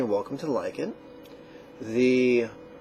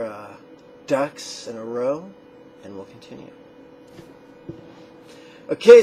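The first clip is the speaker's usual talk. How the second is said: ordinary talk, nothing marked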